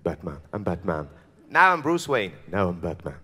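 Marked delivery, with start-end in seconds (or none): 0.0-1.1 very low, hushed superhero growl; Batman. Retold or imitated. Introducing the famous superhero by his voice, deep and hushed. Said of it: "The guy who talks like that". 2.5-3.1 very low, soft superhero growl; Batman. Shown as a demonstration. Showing the hero's voice against his everyday one, deep growl. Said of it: "The guy who talks like that".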